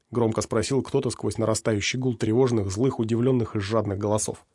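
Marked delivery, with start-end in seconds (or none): none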